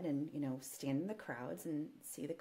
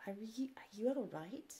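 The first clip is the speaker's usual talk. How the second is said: put-on higher, halting go at the Queen's British accent; attempted British royal accent; 5 semitones higher, 3 dB quieter than usual. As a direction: tentatively mimic a concerned Queen's British accent. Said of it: "I can't do the accent".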